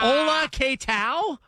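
drawn-out mock Spanish greeting; mock Spanish accent.

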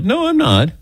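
high-pitched protest, played up; hammed-up high denial; mock realization. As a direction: blurt it as if losing composure. Acting out a tearful relapse.

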